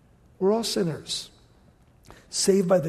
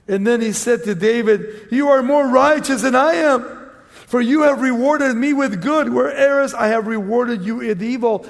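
raised, emphatic Bible reading; scripture reading; 4 semitones higher, 6 dB louder than usual. Acted. Preacher reads scripture to congregation, emphatic and raised. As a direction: read the verse out to the congregation.

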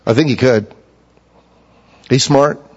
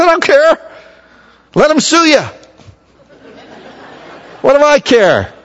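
defiant retort; 9 semitones higher than usual. Retold or imitated. Pastor brushing off the city attorney, high and defiant. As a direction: brush off the attorney's worry with gusto.

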